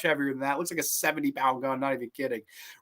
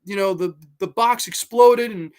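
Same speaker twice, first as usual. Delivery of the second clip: higher, a touch of tearful strain; mock crying; 7 semitones higher, 5 dB louder than usual. Fan retelling Rambo's war story, lightly tearful. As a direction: tell it through held-back tears. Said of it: "When he's crying and he's telling the story".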